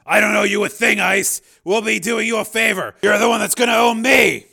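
loud, high-pitched indignant policeman; forceful rebuttal. A narrator's reading. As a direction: push back hard, almost shouting. Policeman forcefully rejects owing a favor.

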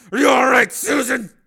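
high, loud, urgent check; alarmed shout. Acted. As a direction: call out to her in alarm. Man calls to fallen woman.

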